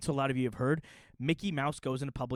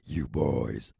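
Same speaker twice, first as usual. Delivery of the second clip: much lower, louder growl; menacing low voice; deep growl; 5 dB louder than usual. Acted. Teasing the viewers in a deep growl.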